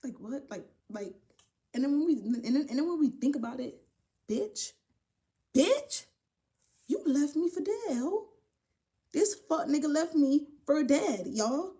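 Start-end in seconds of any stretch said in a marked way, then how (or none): 4.3-11.3 high, loud outraged disbelief; indignant outburst. Acted. Storyteller confronting the man who left her for dead, high indignant outburst. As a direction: call him out, pitch leaping up.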